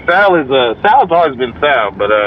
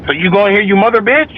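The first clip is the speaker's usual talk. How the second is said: higher-pitched mock outrage; indignant, climbing retort; mock angry; 7 semitones higher than usual. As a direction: imitate a mad friend going off, rising in pitch. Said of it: "so now he's mad".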